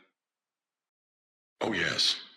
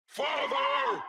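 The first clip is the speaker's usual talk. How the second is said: anguished cry. Acted. Rebellious AI crying out.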